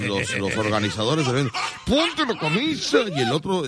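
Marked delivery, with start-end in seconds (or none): 1.8-3.0 much higher put-on order; mock organizers. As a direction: squeak it out like the organizers. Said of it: "decían ahí los organizadores".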